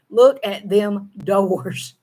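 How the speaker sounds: them doors, slow Southern drawl. Shown as a demonstration. Vlogger contrasting spoken Southern with written English. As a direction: say them doors slowly, the way she talks. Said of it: "If I wrote it".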